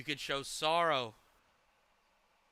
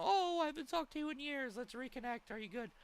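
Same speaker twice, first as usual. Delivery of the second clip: mock sweet ex; 10 semitones higher than usual. Streamer imitating an ex reaching out, higher mock sweetness. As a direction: play the ex coming back sweetly, higher. Mocking an ex reconnecting.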